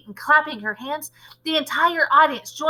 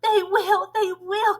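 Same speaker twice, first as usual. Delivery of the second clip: high-pitched chorus of agreeing witches; eager cry; 9 semitones higher than usual. A narrator's reading. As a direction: chime in eagerly, pitched right up. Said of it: "cried the audience".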